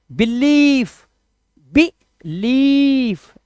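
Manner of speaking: बिलीफ with second syllable held long; short बि, then stretch "leeef" in बिलीफ. Shown as a demonstration. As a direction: model बिलीफ slowly, "bi-LEEEF". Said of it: "sound लंबा जाएगा".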